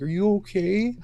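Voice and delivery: put-on high little girl's voice, concerned; daughter's worried question. Little daughter asks her hurt dad, high and worried.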